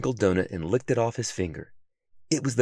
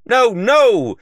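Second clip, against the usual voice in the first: much higher, loud panicked no; loud refusal; 13 semitones higher, 11 dB louder than usual. A narrator's reading. Detective refusing the tempting donuts, loud panic. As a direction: push the temptation away loudly. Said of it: "Sylvester said".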